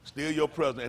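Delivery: ordinary delivery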